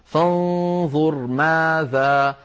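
فانظر ماذا in slow recitation, halting after the whole of ماذا; slow chanted stop on ماذا; stop at word's end. Shown as a demonstration. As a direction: draw out فانظر, keep ماذا as one word to its final long aa. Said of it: "فلا يوقف إلا على آخرها".

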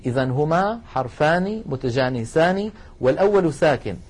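ordinary delivery

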